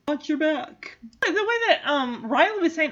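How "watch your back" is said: villain threat. Retold or imitated. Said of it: "they threaten with".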